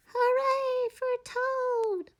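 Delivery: high, slow tiny cheer; small shout. A narrator's reading. Shrinking Frog cheers Toad from the seats, high and tiny. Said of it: "he shouted".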